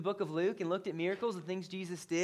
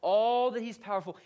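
normal talking voice

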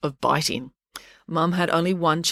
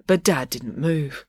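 ordinary delivery

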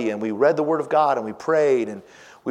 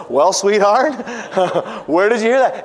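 higher, louder, laughing through the words; laughing reply; 8 semitones higher, 7 dB louder than usual. Real, not acted. Dad laughing as he answers his daughter. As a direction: talk through real laughter.

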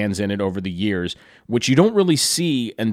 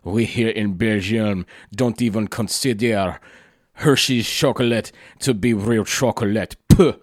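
imitating a friend's thick French accent, dismissive; lightly put-on French accent with a scoffing puff at the end; mild mock French accent, proud and sniffy. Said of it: "in his very thick French accent".

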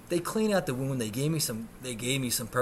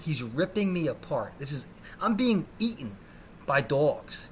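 normal talking voice

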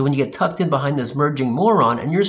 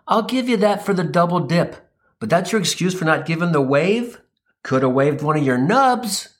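normal talking voice

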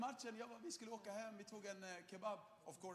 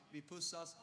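ordinary talk

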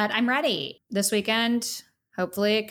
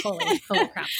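mock stunned, pitched higher; retold holy-crap moment, higher; excited disbelief; 6 semitones higher than usual. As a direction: imitate yourself reading shocking news. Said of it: "I'm like".

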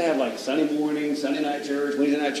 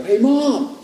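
high imitated child's call; kid calling Mom; 10 semitones higher, 4 dB louder than usual. Boy calls to mother through bathroom door, kid calling.

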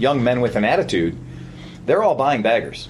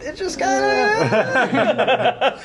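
slow fading laugh, voice dying away; trailing off; 9 semitones higher, much slower than usual. Shown as a demonstration. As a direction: let it trail off into nothing. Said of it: "as his voice trails off into the ether".

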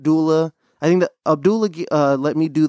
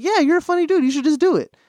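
much higher, playful buddy voice quoting encouragement; imitating Abdullah; 12 semitones higher than usual. Friend urging him to try stand-up, high mock-hype voice. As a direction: pitch way up as the hyped-up friend. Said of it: "he's like".

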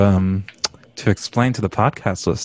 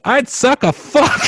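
high, drawn-out mock holler; mock yelling out; slower than usual. Host imitating Allie yelling out an answer, high holler. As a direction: blurt it high and stretched. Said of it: "Allie yelling out".

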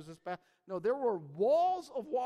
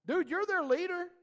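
louder, higher incredulous reproach; acted exasperation; 8 semitones higher, 6 dB louder than usual. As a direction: play an exasperated boss, voice pushed up. Voicing God's exasperated reply to Moses.

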